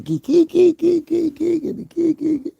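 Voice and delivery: very high-pitched imitation giggle; mock giggling. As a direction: mimic her giggle in squeaky little bursts. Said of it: "smiling and the giggling".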